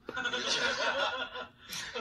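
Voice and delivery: high breathy burst of laughter. Real, not acted. A son laughs, recalling he still failed the exam, bursting out laughing.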